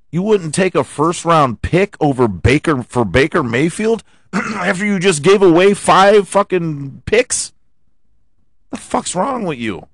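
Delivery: loud, high-pitched furious rant; angry rant. Real, not acted. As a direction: yell at the team's front office. Ranting at Cleveland front office.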